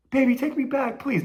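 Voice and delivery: high, whiny pleading in mock; mock begging. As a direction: ham up a pathetic plea to get her back. Mocking a man begging his ex.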